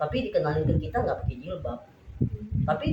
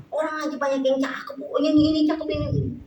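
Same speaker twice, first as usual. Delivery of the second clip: high-pitched motherly protest; exasperated retort; 8 semitones higher than usual. Retold or imitated. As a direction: argue back, pitched well up. Mother keeps arguing with daughter.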